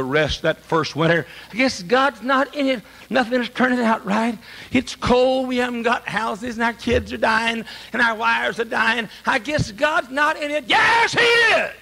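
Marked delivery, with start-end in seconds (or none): 1.5-10.7 a lightly voiced hopeless settler complaining; despairing pilgrim. Preacher voicing Pilgrims losing heart in winter, light despairing voice. 10.7-11.7 high, slow, shouted rebuttal; emphatic shout. Acted. Preacher answering the doubting Pilgrims, slow emphatic shout.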